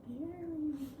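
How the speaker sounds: high, mock scared; high mock fear. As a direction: say it with mock fright.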